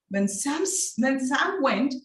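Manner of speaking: ordinary talk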